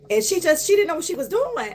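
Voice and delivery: ordinary talk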